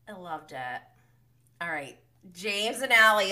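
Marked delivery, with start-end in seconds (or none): none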